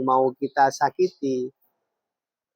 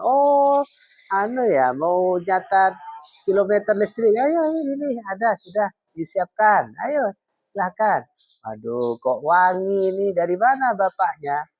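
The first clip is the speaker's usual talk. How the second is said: high, sweetly welcoming housewife, acted; mendadak ramah; 7 semitones higher than usual. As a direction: fuss over a guest in a high, eager-to-please voice. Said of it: "pada suaminya tadi langsung berubah". (istri berubah ramah kepada tamu)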